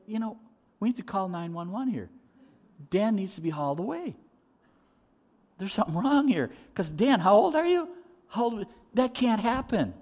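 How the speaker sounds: high-pitched, incredulous onlooker; baffled concern, pitched well up; acted alarmed disbelief. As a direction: play someone worried the speaker has lost it. Acts listeners thinking the speaker crazy.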